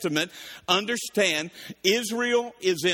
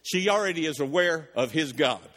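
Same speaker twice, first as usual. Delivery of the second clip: ordinary delivery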